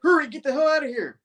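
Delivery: high-pitched hurried order; urgent mock yell. Spidey telling Janice to flee, high urgent yell. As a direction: cry out to send her running. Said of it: "Spidey's like".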